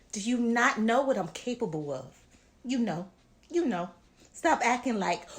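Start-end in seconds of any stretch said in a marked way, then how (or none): none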